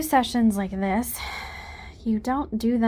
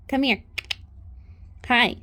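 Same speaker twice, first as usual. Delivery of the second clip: ordinary talk, nothing marked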